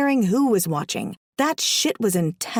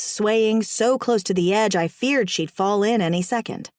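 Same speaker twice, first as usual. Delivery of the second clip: ordinary delivery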